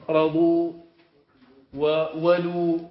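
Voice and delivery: رضوا said slowly and loud, each sound clear; dictated word. Shown as a demonstration. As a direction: dictate رضوا and وولوا to the class. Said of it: "وكذلك رضوا".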